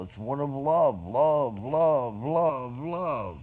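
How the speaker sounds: each love punched loud; love love love stressed hard and loud; repeated love. Real, not acted. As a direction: hammer the word love over and over.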